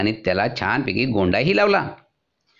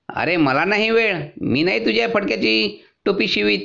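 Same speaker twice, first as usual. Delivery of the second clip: tailor refusing; 9 semitones higher than usual. A narrator's reading. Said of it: "त्याने सांगितलं".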